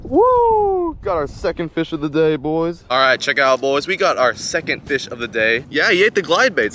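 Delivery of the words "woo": high, loud cheer; excited whoop. Real, not acted. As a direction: whoop it out in triumph. Second fish hooked, cheering.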